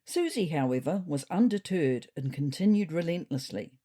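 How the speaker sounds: plain reading voice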